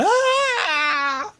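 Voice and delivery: high, drawn-out mock scream; background scream. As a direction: imitate a distant crowd screaming, without full volume. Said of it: "People screaming in the background".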